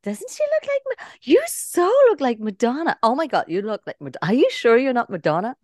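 high, gushing American fan; gushing New Yorker. Retold or imitated. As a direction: squeal it like a starstruck fan.

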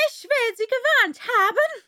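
high, very loud furious scream. A narrator's reading. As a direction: scream a threat at her. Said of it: "schrie die Königin".

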